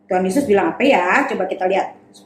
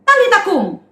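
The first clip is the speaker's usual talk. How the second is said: high, loud command; Jesus commanding the dead girl to rise; 10 semitones higher, 4 dB louder than usual. Acted.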